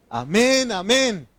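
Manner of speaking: two ringing high amens, shouted out; a congregation's joyful amen; loud amen. Shown as a demonstration. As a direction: answer like the whole crowd. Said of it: "¿Cómo respondió?".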